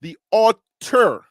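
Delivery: "altar" with a hard, loud T; stretched, emphatic correction of "altar"; corrected pronunciation. Shown as a demonstration. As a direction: slowly sound out "altar" as AWL-TER. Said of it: "not author".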